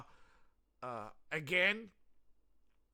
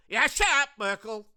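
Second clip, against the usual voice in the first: high loud dismissive shout-off; mock youth; 8 semitones higher, 10 dB louder than usual. German youth telling Merkel off, high loud jeer. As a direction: brush her off like a bored teen. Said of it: "youth of Germany are just going".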